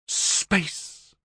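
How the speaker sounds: crisp, firm one-word correction. A narrator's reading. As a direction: correct him firmly. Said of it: "Jezebella said firmly".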